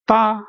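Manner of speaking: "da" held high and level; high flat tone. Shown as a demonstration. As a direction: sustain "da" on one high note.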